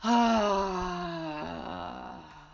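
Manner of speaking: long falling open-mouthed sigh; audible sigh. Shown as a demonstration. Teacher leading class in sigh breaths, long sigh. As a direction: let all the air out on a long sigh. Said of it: "Let's do that sigh three more times".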